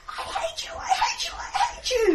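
screamed I hate you, breaking and crying; furious crying shriek; angry screaming. Acted. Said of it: "Sebby screams".

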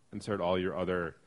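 ordinary talk, nothing marked

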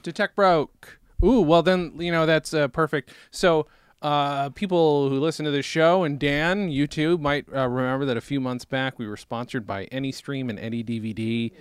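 loud upbeat announcer read. Acted. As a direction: sell it like a radio host.

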